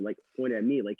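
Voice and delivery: ordinary delivery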